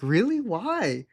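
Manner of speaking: high, swooping imitation of her questioning; mock-curious girl. Date questioning his dislike of Trump, mock-curious. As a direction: play her asking with exaggerated rise and fall.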